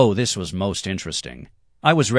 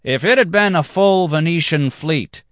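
much higher, louder cry; 11 semitones higher, 6 dB louder than usual. Acted. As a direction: fling it back at them.

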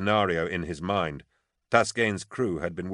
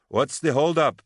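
higher, louder call up a stairway; 8 semitones higher, 6 dB louder than usual. A narrator's reading. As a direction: call it up to someone far above. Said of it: "Elodie called up".

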